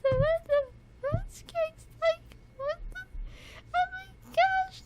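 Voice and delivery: high, laughing squeals of delight; fangirling squeal. Real, not acted. Fan reacting to the singer's delivery with high squeals. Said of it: "feel the fangirling just coming in".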